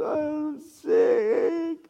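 very high, drawn-out mock whine; whimpering sick daughter. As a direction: wail it like a pitiful sick kid. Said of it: "She goes".

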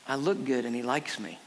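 low, soft, contented voice; acted untroubled calm; happy unbothered guy. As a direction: play someone serenely pleased with himself. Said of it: "He's happily standing there".

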